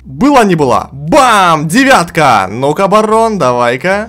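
loud thrilled shouting at the win; shrieking jackpot winner. Real, not acted. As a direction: yell with joy as the bet pays off. Casino bet won, shouting.

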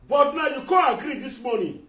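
loud, high outburst of anger; angry shout. Real, not acted. Ranting at Osagi on live video, angry shout. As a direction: shout it at him in fury.

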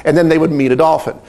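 ordinary talk, nothing marked

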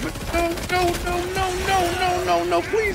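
high, hammed-up pleading no no no; mock panic. A player facing his own rocket blast pleads in a high mock panic. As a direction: beg for mercy in a squeaky mock panic. Dreading a blast after mistake.

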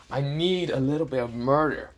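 a touch of bloodthirsty pleading; whining for bloodshed. Acted. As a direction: plead mildly to be let loose. Dragon whining for a kill.

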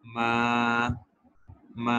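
मा level and sustained; first tone. Shown as a demonstration. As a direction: hold मा flat. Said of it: "first tone हमारे पास है". (पहली tone मा सपाट)

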